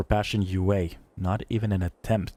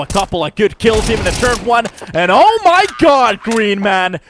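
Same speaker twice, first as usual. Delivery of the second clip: rising caster hype; 9 semitones higher, 8 dB louder than usual. Real, not acted. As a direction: pitch and volume climb to a loud excited scream. Caster building to a round-winning climax.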